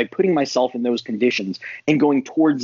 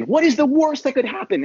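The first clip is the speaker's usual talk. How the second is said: mock dramatic question; 12 semitones higher than usual. Shown as a demonstration. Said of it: "to just simply say".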